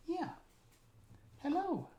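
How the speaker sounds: high, lilting pet hello; puppy greeting. Real, not acted. Owner greeting puppy on camera, high pet-talk hello.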